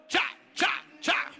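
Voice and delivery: high voiced ta-da beats; mock fanfare; vocal fanfare. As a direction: sound out a fanfare. Imitating a fanfare.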